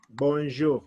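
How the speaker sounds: "Bonjour" with the R like KH, from the throat. Shown as a demonstration. Teacher models the last letter for beginners, throaty R. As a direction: say "Bonjour", bon-zhoo ending in throaty R. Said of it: "pronounce it from your throat".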